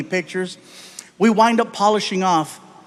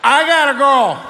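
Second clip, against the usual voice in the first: put-on drunk whining; 7 semitones higher, 4 dB louder than usual. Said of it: "drunk childlike plea".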